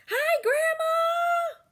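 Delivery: very high, slow, loud shout. Acted. Girl greeting grandma with a big shout. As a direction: shout the hello across the room.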